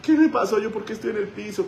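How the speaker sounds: high, bewildered questions; confused woman. Retold or imitated. Pastor voices the confused woman asking what happened, high and bewildered. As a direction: ask as if just waking on the floor.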